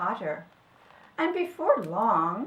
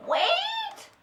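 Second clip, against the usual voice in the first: very high, pleading squeal; little creature begging them to stop; crying badger. A narrator's reading. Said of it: "cried the badger tea kettle".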